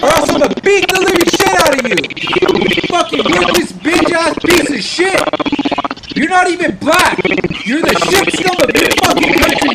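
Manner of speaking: high, loud raging yells at an enemy player; furious screaming. Real, not acted. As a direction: lose it completely and scream him down. Screaming at an opponent in chat.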